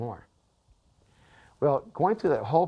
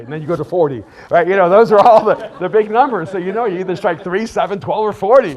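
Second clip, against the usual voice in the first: loud, climbing sermon voice, much higher; full-force preaching; 6 semitones higher, 14 dB louder than usual. Acted.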